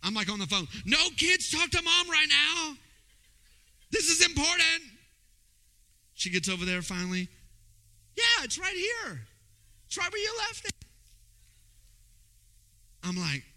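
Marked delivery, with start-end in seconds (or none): 0.8-4.9 hammed-up urgency, dragging the words out; mock urgent plea. Shushing kids on phone. 8.2-10.7 a breezy higher voice for the wife; imitating wife.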